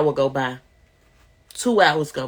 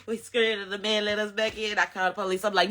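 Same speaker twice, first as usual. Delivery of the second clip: usual speaking voice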